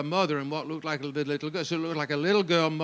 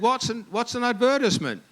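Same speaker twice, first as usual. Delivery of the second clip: high, puzzled query; retold incredulous question; baffled challenge; 7 semitones higher than usual. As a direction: question the word in mock bafflement, pitched high. Retold baffled challenge to his wording.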